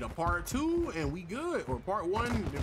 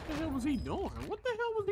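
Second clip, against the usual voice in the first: baffled disbelief; 13 semitones higher than usual. Real, not acted. Baffled by an enemy.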